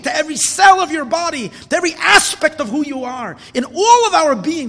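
loud, driving sermon voice, pushing every phrase up; fiery preaching. Acted. Impassioned preacher, loud and high. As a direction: rabbi urging the congregation to fuse heaven and earth, preaching at full force.

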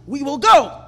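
loud, high emphatic declaration; forceful. Acted. Teacher acts out Kalev talking forcefully to the people, forceful. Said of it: "So he was talking forcefully".

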